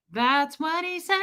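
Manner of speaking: high-pitched can-you-believe-it; incredulous repeat. Real, not acted. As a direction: repeat it in a high, stretched voice climbing at the end. Repeats it in heightened disbelief.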